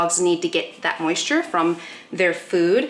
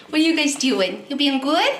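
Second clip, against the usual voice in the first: talking to the dogs; 6 semitones higher than usual. Real, not acted. High talk to dogs.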